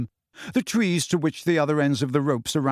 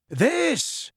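high, raised character call; high-pitched attention-getter; Hagrid introducing someone; 12 semitones higher than usual. Acted. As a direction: announce it up to a giant. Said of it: "said Hagrid".

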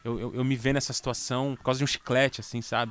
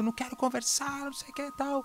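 put-on high-pitched childish scream, pulled back in volume; mock screaming child; 13 semitones higher, 5 dB quieter than usual. Dad retells his daughter screaming she won't talk, high mock child's scream. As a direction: shriek it like a little girl refusing to talk.

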